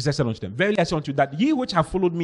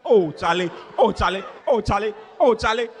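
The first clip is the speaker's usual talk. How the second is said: repeated exclamation, climbing higher each time; mock dismay; 5 semitones higher than usual. Churchgoers react to what the pastor said, in mock dismay. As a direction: play up the scandalised reaction, building. Acted reaction to scandalous sermon report.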